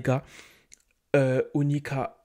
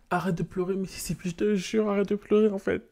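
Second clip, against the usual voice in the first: soothing; 6 semitones higher than usual. Acted. Soothing crying Sim.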